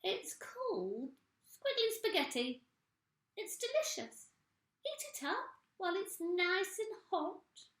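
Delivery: high, falsely sweet voice; sugary old woman. Acted. Missus Twit sweet-talking her husband into eating. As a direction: coax him sweetly.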